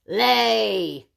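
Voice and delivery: loud gruff command; giant's order. A narrator's reading. Giant commanding the hen, loud order. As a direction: bark it at the hen. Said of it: "ordered the giant".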